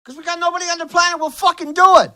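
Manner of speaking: real angry outburst; angry shouting. Real, not acted. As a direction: hammer it home furiously. Angry rant.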